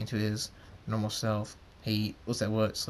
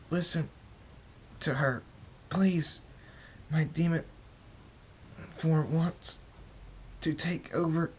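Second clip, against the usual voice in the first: higher, halting and labored; strained plea; 6 semitones higher than usual. Acted. Hurt Draconix pleads as his demon takes over, strained. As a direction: beg through effort, with long breaks.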